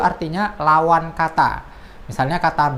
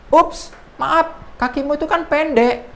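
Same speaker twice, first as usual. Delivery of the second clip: much higher, louder mocking character voice; mock apology; 11 semitones higher, 4 dB louder, slower than usual. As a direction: tease the ant with a fake sorry. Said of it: "Baru percakapan lagi".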